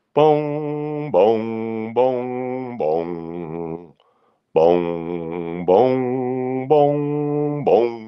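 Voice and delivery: sung booms like a big clock striking; playful mock chimes for noon; imitating clock chimes. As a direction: chime out each boom like a town clock. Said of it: "I'm not gonna do twelve".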